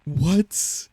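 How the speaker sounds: high, rising astonished "what"; real astonishment. Real, not acted. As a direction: disbelief bursting out. Reacting to the result.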